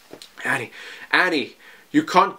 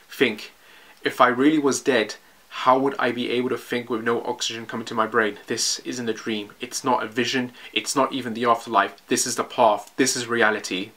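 put-on hushed, low young soldier realising the truth; softer and lower, almost to himself; Armin's inner monologue; 4 semitones lower, 5 dB quieter than usual. Rereads Armin's monologue more quietly.

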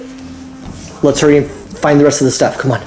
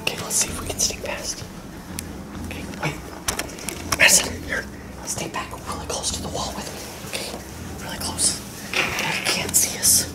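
hushed sneaking whisper; 11 semitones higher, 13 dB quieter than usual. Real, not acted. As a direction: whisper so the neighbor can't hear.

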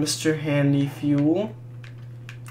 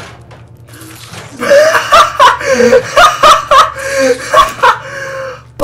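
screaming high cackle of laughter; loud, very high shrieking laughter; 13 dB louder than usual. Real, not acted. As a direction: lose it completely, shrieking. Bursting out at funny game moment.

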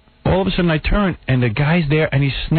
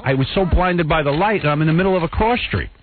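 ordinary delivery

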